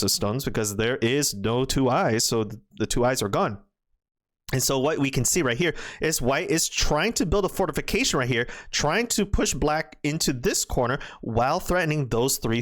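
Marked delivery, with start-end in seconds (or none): none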